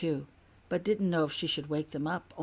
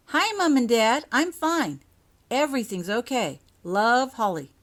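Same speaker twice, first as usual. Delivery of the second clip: put-on high, loud young girl's voice; girl's happy note; 7 semitones higher, 8 dB louder than usual. Daughter writing to her parents, high bright girl's voice.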